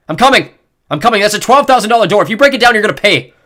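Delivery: loud high indignant yell. A narrator's reading. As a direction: yell it toward the door. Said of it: "Jen's dad yelling".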